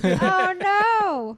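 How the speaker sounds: high, loud oh no; playful dismay. Real, not acted. Player reacting to the joke about getting presidents, high playful dismay. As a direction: wail it in mock alarm.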